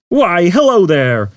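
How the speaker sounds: cheery computer. A narrator's reading. Computer greeting Zaphod, sugary cheer. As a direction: greet like a game-show host.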